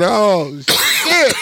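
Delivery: high, loud mock dismay. Told they're out of sandwiches, a high mock wail of dismay. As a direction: wail it like your day is ruined. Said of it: "I said".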